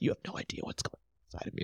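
fast, hushed whisper of hidden turmoil; whispered inner voice. Retold or imitated. Whispering her hidden inner feelings to the listener. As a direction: breathe it out like a secret held in.

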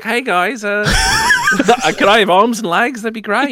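a hint of a shy little robot asking for limbs; joking impression of a talking droid; Taika Waititi droid. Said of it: "Taika Waititi becoming a talking ... astromech droid, being like".

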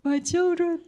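high, fond sigh of a mother; melting mother. Retold or imitated. A mother melting at her son's hug, high and fond. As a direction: melt over your grown kids. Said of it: "it's like".

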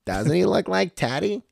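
put-on slow, high Boston accent. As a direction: drawl it like a Boston local. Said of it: "give me the Boston accent".

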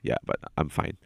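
breathy whisper, insisting he's fine; whispered brush-off. Retold or imitated. Brushing off his girlfriend in a whisper. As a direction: whisper it, not convincing. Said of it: "I was like".

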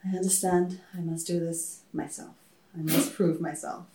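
low, hushed vow in character; solemn resolve. Acted. Character answering a sensed call in prayer, low and solemn. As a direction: answer a divine feeling quietly and solemnly. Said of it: "I get a feeling and I'm like".

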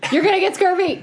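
loud teasing yell; playful shout. Real, not acted.

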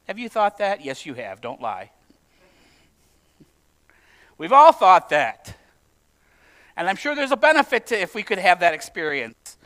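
ordinary delivery

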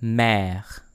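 Mère modelled with open "eh" and French r. Shown as a demonstration. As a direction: say Mère carefully, open vowel held. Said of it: "words with this sound".

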